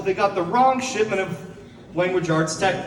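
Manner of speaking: normal talking voice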